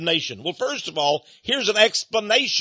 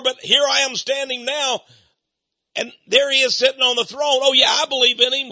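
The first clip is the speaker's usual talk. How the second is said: mock pleading sinner, higher and louder; 6 semitones higher, 3 dB louder than usual. Sinner begging God at the judgment seat, mock pleading. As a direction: beg for another chance, hammed-up.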